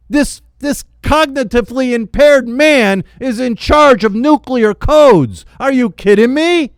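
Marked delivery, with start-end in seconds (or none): none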